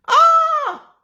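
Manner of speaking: very high, loud yelp; startled high yell; sudden shout. Real, not acted. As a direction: burst out with a shrill cry. Sudden outburst in live talk.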